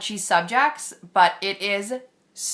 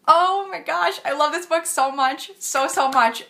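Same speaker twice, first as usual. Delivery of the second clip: thrilled gush; 9 semitones higher, 3 dB louder than usual. Real, not acted. Gushing about a favourite book.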